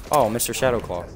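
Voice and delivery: ordinary talk, nothing marked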